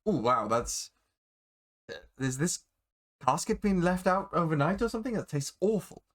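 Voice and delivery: politely appalled, pitch climbing; lightly acted distaste after a sip; woman reacting to drink. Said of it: "sips from it and goes".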